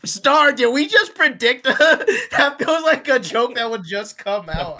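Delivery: high, loud, breaking into laughter; real laughter pushing the voice high; laughing in disbelief. Real, not acted. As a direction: crack up while getting the words out. Cracking up at a predicted joke.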